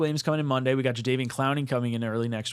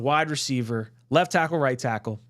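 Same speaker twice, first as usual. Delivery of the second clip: ordinary talk, nothing marked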